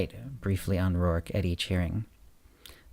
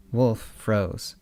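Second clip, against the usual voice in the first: usual speaking voice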